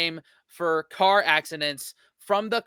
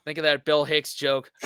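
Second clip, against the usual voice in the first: normal talking voice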